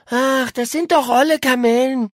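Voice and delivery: high-voiced, dismissive moan; unimpressed groan. A narrator's reading. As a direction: groan it as tired old news, high-pitched. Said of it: "stöhnte Paula unbeeindruckt". (unbeeindruckt und hoch gestöhnt)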